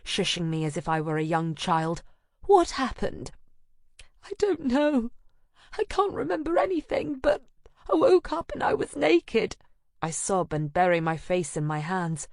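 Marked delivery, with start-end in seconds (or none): 2.4-3.3 high gentle question; concerned friend. Acted. Anna gently asking. 4.2-9.6 high, breaking tearful voice; fighting back sobs. A narrator's reading. Narrator breaking down, sobbing follows.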